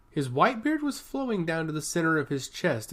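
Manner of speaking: usual speaking voice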